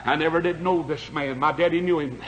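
rising and falling revival preacher, shouting peaks; fiery preaching. Acted. Revival preacher to his congregation, fiery preaching.

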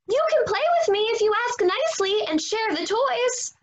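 high, quick little pig voice. Acted.

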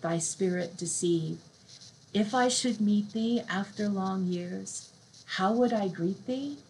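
slow, soft poetry recital; slow hushed reading of a sad love poem. Acted. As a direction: let each line sink in slowly. Reads Byron poem aloud.

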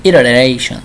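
"Iteration" repeated after the translator, in a Spanish accent; "Iteration" tried out as "iroreishon"; copying the pronunciation. Shown as a demonstration. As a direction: echo "Iteration" with Spanish vowels. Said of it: "¿Cómo se pronuncia".

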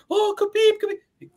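very high mocking fawning voice; fussing teammates. Mocking Khabib's teammates fussing over him, very high and fawning. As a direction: squeal it like doting teammates. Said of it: "putting the towels on him".

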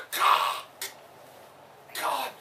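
usual speaking voice

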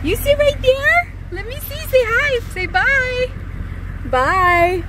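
high sweet voice. Real, not acted. Mom saying goodbye to her daughter on the bus, high baby-talk.

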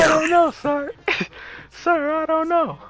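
high, squeaky mock recruit; mock soldier reply. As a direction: answer like a scared private. Mocking teammate as soldier.

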